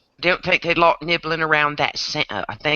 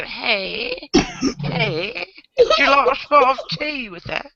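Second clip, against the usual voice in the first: high, pinched mock British; British accent through barely opened mouth; tight-mouthed British; 8 semitones higher, slower than usual. Shown as a demonstration. Mimicking how the British say hey, high tight British accent. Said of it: "they're trying to go".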